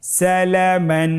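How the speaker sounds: slow, drawn-out level chant; measured letter-by-letter chanting; recitation drill. Shown as a demonstration. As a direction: lead learners through each syllable, holding the vowels. Leads a spelling drill.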